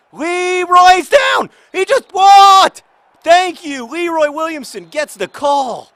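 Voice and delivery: high, loud, stretched protest; outraged shout. Real, not acted. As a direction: bellow at the foul. Outraged at foul in the game.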